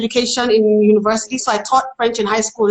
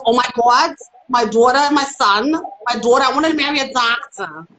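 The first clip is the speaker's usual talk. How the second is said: Dolores. Pretend. Mother bragging about her children, New Jersey accent. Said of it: "she talks like this".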